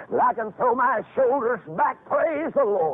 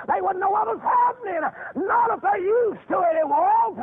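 preaching shout; 9 semitones higher than usual. Acted. Preacher answering objection.

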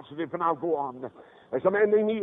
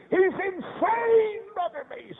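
high, very slow imitated wail; anguished outcry; much slower than usual. Mother telling the preacher about her institutionalized son, high wailing grief.

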